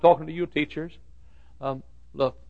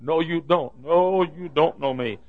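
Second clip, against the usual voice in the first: higher, drawn-out refusal; slow, emphatic brush-off; insistent denial; 5 semitones higher, slower than usual. Retold or imitated. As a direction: stretch out the denial, slowing down. Denying recognition to a fellow passenger.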